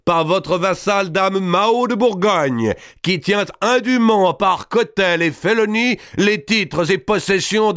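high, ringing voice of a proud giant lord; booming Robert. A narrator's reading. Robert addresses the king before his vassals, high and proud.

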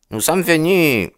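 high-pitched, pushy suitor's voice; high, insistent young man; eager young suitor. A narrator's reading. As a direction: pitch it up high, pleading a case.

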